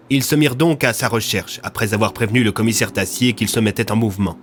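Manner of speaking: ordinary delivery, nothing marked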